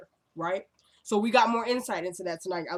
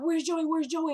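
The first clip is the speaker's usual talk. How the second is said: a touch of panic, pitched up, calling for Joey; lightly retold frantic asking; mild mock crying; 7 semitones higher than usual. Recapping the show, imitating Fawn crying for Joey, mild mock panic. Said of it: "And she's crying".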